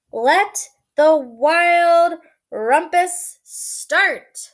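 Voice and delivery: cried out. Acted. Max the wild boy king cries out to start the rumpus. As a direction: call the rumpus to begin. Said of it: "cried Max".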